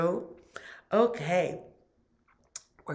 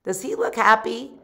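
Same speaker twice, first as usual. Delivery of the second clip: plain reading voice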